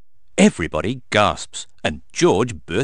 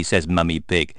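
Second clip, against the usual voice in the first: usual speaking voice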